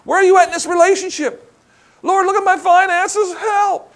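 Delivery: high, desperate pleading prayer; pleading cry to God. Retold or imitated. Preacher voicing a believer crying to the Lord for help, high and pleading. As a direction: cry out to the Lord in helpless despair. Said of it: "how often do we cry".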